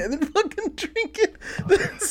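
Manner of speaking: high, breaking into laughter; laughing. Real, not acted. Describing his meme idea to friends, cracking up high. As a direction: dissolve into giggles mid-sentence. Imagining drinking Goo Gone.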